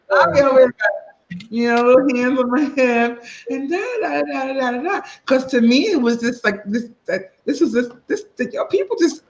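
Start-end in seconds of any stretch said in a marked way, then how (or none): 0.1-5.0 put-on higher, sassy little-kid voice with hands on hip; sassy little girl. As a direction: play yourself as a bossy child. Child self with hands on hip.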